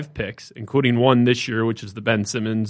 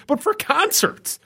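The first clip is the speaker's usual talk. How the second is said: much higher, slow disbelieving stretch; incredulous; slower than usual. Real, not acted. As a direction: drag it out in outrage. Ranting about ban.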